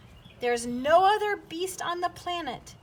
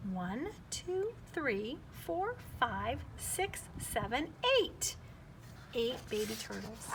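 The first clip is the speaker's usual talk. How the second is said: soft counting; 2 semitones lower, 8 dB quieter than usual. Acted. Counting turtle babies to children.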